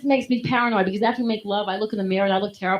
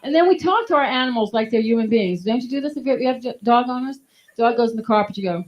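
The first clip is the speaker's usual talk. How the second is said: ordinary talk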